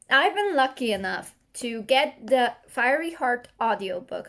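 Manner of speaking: usual speaking voice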